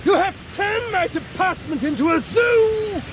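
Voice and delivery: high-pitched, ranting declamation; frenzied battle cry, voice straining high; raging climax. Acted. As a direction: build to a drawn-out final shout. Climax of tirade in boat.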